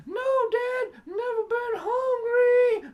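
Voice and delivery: put-on very high, slow crying child voice; whimpering little kid. Son shrunk small answering his father tearfully. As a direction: whine like a cowed little boy. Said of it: "I go".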